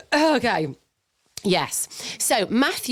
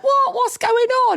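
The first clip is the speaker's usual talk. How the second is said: high, loud startled cry; startled what; 4 dB louder than usual. Retold or imitated. Alone in the changing room, a startled high cry. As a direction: jump out of your skin. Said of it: "and I went".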